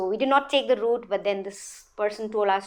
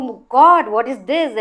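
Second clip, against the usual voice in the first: retold horror, louder on "Oh God"; shocked exclamation; 3 semitones higher, 8 dB louder than usual. Witness reacting to the blood splashing, shocked exclamation. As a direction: blurt it out louder, aghast.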